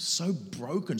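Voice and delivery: usual speaking voice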